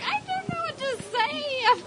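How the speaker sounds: high-pitched, drawn-out mock helplessness; flustered kid whine. Telling how mom pushed her to speak, mock flustered whine. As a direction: imitate a stuck youngster, voice way up.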